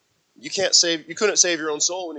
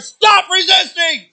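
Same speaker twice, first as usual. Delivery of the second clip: much higher, loud, slow shout; police yell; 14 dB louder, slower than usual. Retold or imitated. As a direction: bellow it like a cop pinning someone. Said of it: "He's like".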